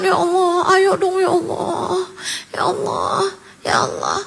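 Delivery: soft pleading prayer with a catch of tears; earnest tearful prayer. Shown as a demonstration. Preacher demonstrating how to pray in earnest, tearful and pleading. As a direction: beg God softly, almost crying. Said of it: "Begitu kalau doa yang serius".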